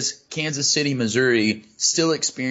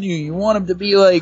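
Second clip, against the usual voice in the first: usual speaking voice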